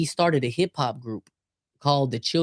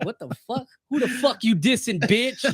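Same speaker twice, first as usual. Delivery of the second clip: mock aggressive battle rapper; 7 semitones higher than usual.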